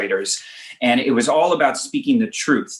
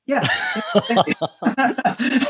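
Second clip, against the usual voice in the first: big real laugh, pitched up; hearty laugh; 10 semitones higher than usual. Real, not acted. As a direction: burst out laughing. Laughing at his own story.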